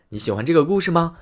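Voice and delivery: ordinary delivery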